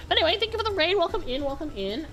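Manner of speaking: cute anime streamer. Real, not acted. Greeting an incoming raid.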